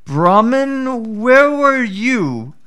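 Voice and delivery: a crafty jackal pretending to be slow-witted; sly jackal. Jackal voiced in folk tale.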